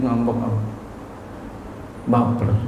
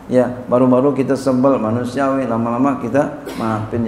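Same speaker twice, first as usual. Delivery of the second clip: usual speaking voice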